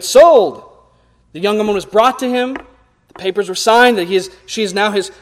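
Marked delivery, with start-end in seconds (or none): 0.0-0.6 loud, higher auctioneer's call; shouted. Retold or imitated. Auctioneer closes the sale to the crowd, shouting. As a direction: bang it out like a gavel. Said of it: "The auctioneer shouted".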